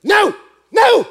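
high, loud, punchy yell; imitating an emphatic objection; shouted refusal. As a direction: shout it in blunt disbelief. Man rejects the answer.

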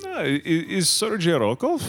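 fake Russian accent. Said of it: "Make a little accent".

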